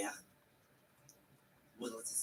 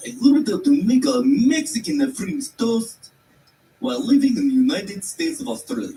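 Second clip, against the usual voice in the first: hammed-up Russian accent; 9 semitones higher, 8 dB louder than usual. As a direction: belt it out in an overdone Russian accent. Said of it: "four cultures in one sentence right there".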